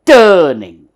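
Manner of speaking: TURNING with a loud falling "tuh", no R; "turning" without R or hard G, heavily stressed; correct pronunciation. Shown as a demonstration. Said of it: "It comes from inside".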